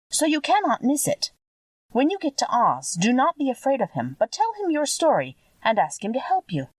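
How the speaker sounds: a hint of a motherly witch breaking sad news; kindly old witch, gently regretful. A narrator's reading. Witch of the North telling Dorothy she must stay, lightly voiced kind old woman.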